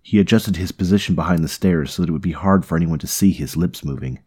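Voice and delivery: usual speaking voice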